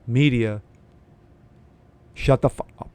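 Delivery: loud, forceful mock outburst at the media; blunt mock shout. Vlogger voicing the president telling the media off, loud mock shout. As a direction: yell it like you're fed up with the press. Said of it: "That's what basically he's saying".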